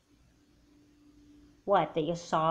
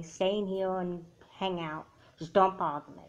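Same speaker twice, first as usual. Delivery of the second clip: normal talking voice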